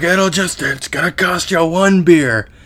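high-pitched, put-on bargaining voice; imitated mugger. Bum demanding his ransom from a teenager, imitated mugger. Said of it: "He's like".

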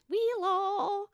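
soft, high, slow pet-talk; voice for the dog. Shown as a demonstration. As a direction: croon a drawn-out greeting to a dog. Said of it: "What are your voices for them?".

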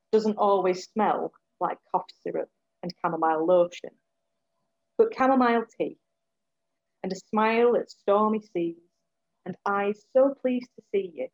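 measured spoken word delivery; poem reading. Acted. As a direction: read it as a poem, with weight. Reads her poem aloud.

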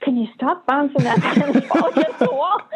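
high, laughing through the plea; laughing request. Retold or imitated. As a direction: ask it while laughing. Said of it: "I'd be like".

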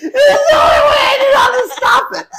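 real laugh-talk; laughing while talking. Real, not acted. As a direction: push it out through laughter.

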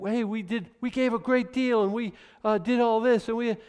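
high, pleading wife voice; mock wife pleading. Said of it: "she's like".

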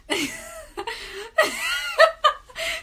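high shrieking laugh; loud burst of laughter. Real, not acted. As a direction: explode into laughter.

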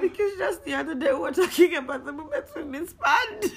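high, loud giggling speech; laughing. Real, not acted. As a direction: talk while cracking up. Story told through giggles.

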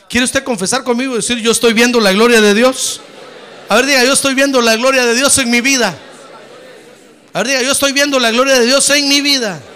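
loud call-and-response preaching. Acted. Pastor leading the congregation in loud confession. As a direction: lead the church in declaring it.

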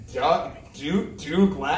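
"Douglas" haltingly sounded out as DOO-glass; mock mispronunciation. Ancient people puzzle over modern names, "Douglas" misread as DOO-glass. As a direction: stumble on "Douglas" as if never seen before, DOO-glass. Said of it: "how do you say Douglas?".

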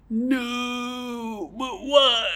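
high shriek of a desperate little fish. Pretend. Caught fish shrieking at its captors, very high. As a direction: wail it, way up in pitch.

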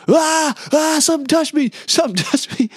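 high, loud mock scream; hammed-up shriek of alarm; freaking out. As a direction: imitate a swimmer in sheer panic. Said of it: "he's legit freaking out".

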